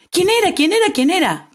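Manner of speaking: high, excited repeated questions; a crowd of animals eager to be named; eager crowd asking. Acted. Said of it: "preguntaban todos".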